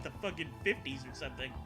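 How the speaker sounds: ordinary talk, nothing marked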